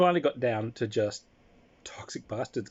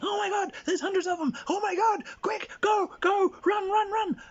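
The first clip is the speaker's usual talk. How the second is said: high, loud fake panic; panicked scream; 4 dB louder than usual. Scout fakes panic to the party, screaming high. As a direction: shriek like a terrified scout. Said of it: "you start screaming".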